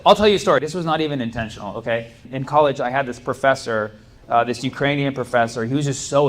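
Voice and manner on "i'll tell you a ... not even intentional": ordinary talk, nothing marked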